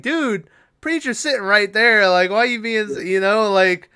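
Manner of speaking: high, loud, disbelieving "dude" at the screen; loud retold disbelief at a movie scene; incredulous outburst. Retelling own reaction to scene.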